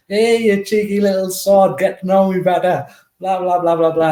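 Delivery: a hammed-up manager telling off a cheeky boy; imitating Brian Clough. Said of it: "And he's like".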